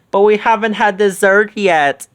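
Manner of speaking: high, loud excuse; lightly voiced Shaggy. A narrator's reading. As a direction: plead hungrily. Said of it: "Shaggy told his friends".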